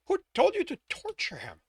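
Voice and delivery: high shocked question. Acted. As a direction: demand it in shock. Woman shocked.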